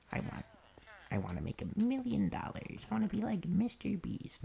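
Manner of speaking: put-on high voice ridiculing big dreams; mock eager wannabe. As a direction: squeal it like a fame-hungry YouTuber. Mimics ambitious wannabe content creator.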